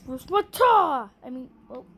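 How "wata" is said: battle cry. Acted. Fighting move, loud shout.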